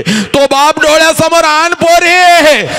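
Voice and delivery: high, loud impassioned call to the students; impassioned cry. Acted. Speaker urging the girls to see their father, in a high loud cry. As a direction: cry it out, pushing them to picture him. Emotional climax of address.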